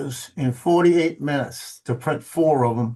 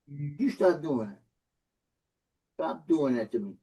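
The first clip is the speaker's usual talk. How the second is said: quiet scolding; 7 dB quieter than usual. Real, not acted. Scolding the dog face to face.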